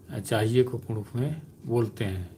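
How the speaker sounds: ordinary delivery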